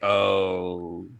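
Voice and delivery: normal talking voice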